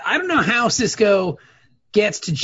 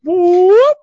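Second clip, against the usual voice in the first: excited whoop; 11 semitones higher, 9 dB louder than usual. Acted. Cheer after fanfare.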